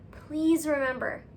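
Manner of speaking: ordinary talk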